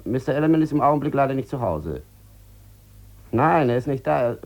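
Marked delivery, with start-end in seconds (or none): none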